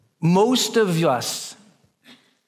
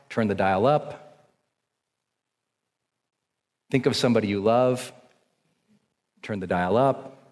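guided breathing; 4 semitones lower than usual. Acted. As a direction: talk the room down into calm. Leads a breathing exercise.

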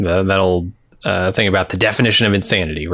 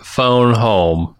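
Baltimore accent try; 4 semitones higher, much slower than usual. Shown as a demonstration. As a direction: stretch the long vowels of "Baltimore, phone home" in a Baltimore accent. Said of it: "Would you like to try a Baltimore accent".

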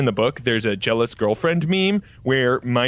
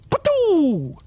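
spitting noise. Acted. Imitating spitting out.

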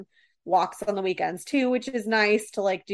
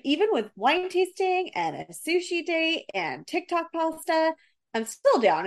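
surprised; 9 semitones higher than usual. Retold or imitated. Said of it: "I'm like".

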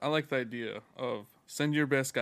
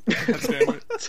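laughing; 9 semitones higher, much slower than usual. Real, not acted. Reacting to Mayweather pick.

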